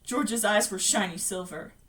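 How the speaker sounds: ordinary delivery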